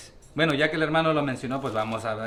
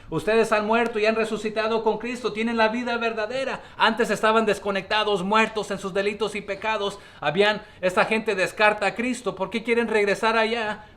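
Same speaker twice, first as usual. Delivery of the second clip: higher, fervent preaching peak; impassioned preaching; 6 semitones higher than usual. Acted. Pastor urging the congregation, higher and fervent. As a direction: lift the congregation with rising fervor.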